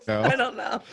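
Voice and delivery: high, helpless giggly reply; laughing. Real, not acted. Friend asked why he lied, laughing. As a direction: answer through real laughter. Answers while cracking up.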